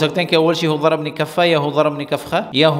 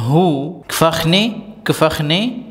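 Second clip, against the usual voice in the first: slow drill; 3 semitones higher, much slower than usual. Shown as a demonstration. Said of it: "teacher drills word slowly".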